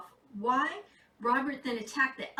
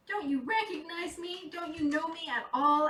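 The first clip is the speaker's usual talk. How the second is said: higher, pleading Santa; 6 semitones higher than usual. A narrator's reading. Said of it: "asked Santa".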